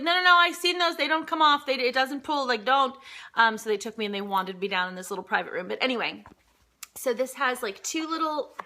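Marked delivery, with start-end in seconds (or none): 0.0-3.0 insistent TSA agent. Retold or imitated. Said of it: "the T S A agent lady, she's like".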